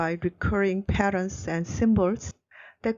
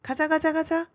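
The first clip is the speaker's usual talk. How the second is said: high, light rallying cry; mock yell; 8 semitones higher, much faster than usual. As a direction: imitate an eager yell at normal volume. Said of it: "And Joey also yells".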